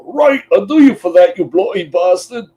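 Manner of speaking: high, loud hammed-up British tough-guy accent; a loud mock British insult, pitched well up. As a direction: snarl it like a cartoon British brawler. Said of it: "Except with a British accent".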